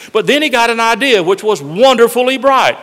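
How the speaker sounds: put-on lively, loud read-aloud for children; storybook reading. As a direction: tell it like a bedtime rhyme to grandkids. Reads Dr Seuss story aloud.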